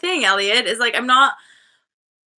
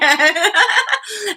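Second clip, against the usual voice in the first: high, loud burst of laughter; real laugh; 9 semitones higher, 5 dB louder than usual. Real, not acted. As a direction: burst out laughing at your own story. Laughs at own story.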